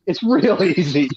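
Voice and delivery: real chuckle inside the line; laughing. Real, not acted. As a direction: break into a laugh mid-phrase. Cracks up at own insult list.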